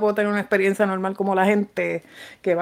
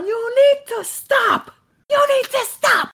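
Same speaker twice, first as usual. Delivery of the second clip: very high, slow, drawn-out scream; acted-out shouted order, high and slow; retold furious yell; much slower than usual. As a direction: scream it, stretching each word. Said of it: "le grité bien cabrón".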